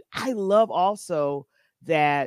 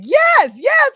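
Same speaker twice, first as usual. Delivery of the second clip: high loud laughing yes; excited shout; 11 dB louder than usual. Retold or imitated. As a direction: shout it gleefully. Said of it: "you were like".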